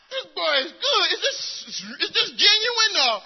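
loud, high, half-laughing doubt; laughing disbelief. Retold or imitated. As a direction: wonder aloud if the boy is faking it. His own doubting thoughts, laughing.

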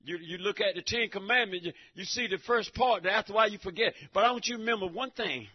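normal talking voice

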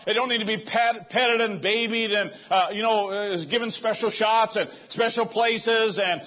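high, loud fervent preaching; preaching at full force. Real, not acted. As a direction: build it up and shout it to the church. Preaching climax.

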